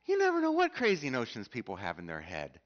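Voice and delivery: ordinary talk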